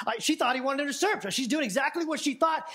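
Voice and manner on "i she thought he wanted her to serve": normal talking voice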